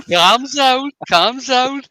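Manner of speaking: high, loud mock Scouse; Scouse accent. Echoing a friend's Scouse impression, exaggerated accent. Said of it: "what about a Scouse accent then".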